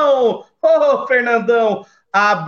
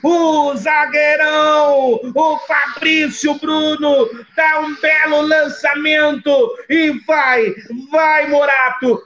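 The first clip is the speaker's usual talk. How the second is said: explosive goal commentary; 4 semitones higher than usual. Acted. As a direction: shout the goal, draw it out. Goal scored, shouted call.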